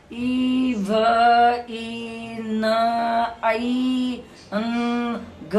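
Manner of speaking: "e, v, e, n, I, n, g" as long isolated phonic sounds; "e, v, e, n, I, n, g" chanted as separate drawn-out sounds; separate letter sounds. Shown as a demonstration. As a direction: sound out "e, v, e, n, I, n, g" one by one, sustained. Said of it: "Have I pronounced like that?".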